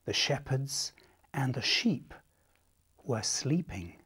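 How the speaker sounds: soft whispered storytelling; quiet night whisper; hushed whisper. Shown as a demonstration. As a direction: hush the room.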